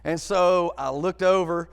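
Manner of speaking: usual speaking voice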